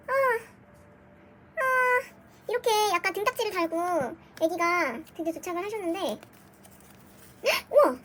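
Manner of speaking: ordinary talk, nothing marked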